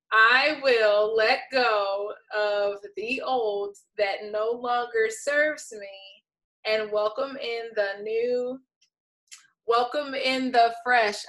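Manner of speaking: very slow, deliberate reading, word by word; careful re-reading. Shown as a demonstration. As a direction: read it out slowly and carefully, stretching each phrase. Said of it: "Let me read that again".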